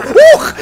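very high, loud whoop of shock; startled yelp. Real, not acted. Player startled by an enemy, high yelp. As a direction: jump with a sudden squeal.

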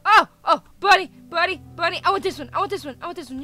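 loud, breathless bunny-spotting thrill; excited outburst. Real, not acted. Player spots a bunny to keep, excitedly blurting.

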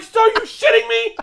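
high, loud disbelief; incredulous shout. Retold or imitated. Comic replays his reaction to a staged collapse, incredulous shout. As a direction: yell it as if utterly flabbergasted. Acted disbelief after the stunt.

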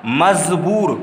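मजबूर with a clear z sound, majboor becomes mazboor; मजबूर pronounced with z, not j; nukta pronunciation. Shown as a demonstration. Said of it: "इसे कैसे उच्चारण करते हैं इसका?".

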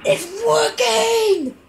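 high, slow, laughing cry of joy; thrilled it finally works; excited. Real, not acted. Gamer cheers at the screen, high and delighted. Game finally starts working.

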